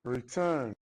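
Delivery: "Return" with a weak Re and strong TURN; second-syllable stress. Shown as a demonstration. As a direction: lay the emphasis on the last syllable of "Return". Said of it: "you apply more strength to it".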